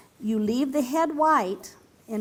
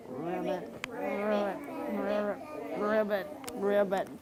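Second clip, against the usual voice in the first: softer, lower croaking ribbits; frog croak; 3 semitones lower, 7 dB quieter than usual. Shown as a demonstration. Teacher croaking like a frog for children, low and soft. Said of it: "Can you sound like a frog?".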